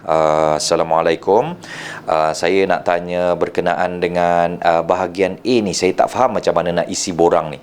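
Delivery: low, even, hesitant asking; hesitant low-voiced customer. Shown as a demonstration. Unsure visitor asks for help with a form, low and hesitant. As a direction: speak down low with small hesitations. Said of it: "kita match and mirrorkan tone suara dia".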